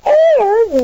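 surprised. Acted. Wife baffled by husband's joke.

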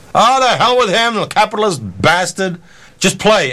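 high-pitched mock heckle from the crowd; audience heckler. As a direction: imitate an indignant fan calling out. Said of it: "somebody in the audience says".

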